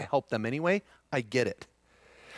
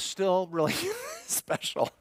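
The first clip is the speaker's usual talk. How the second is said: laughing; 6 semitones higher, slower than usual. Real, not acted. Laughs while recalling touching moment.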